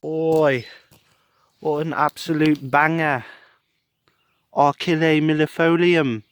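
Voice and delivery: high, hammed-up British accent. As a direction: exclaim it in a playful British accent. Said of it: "doing a British accent this morning".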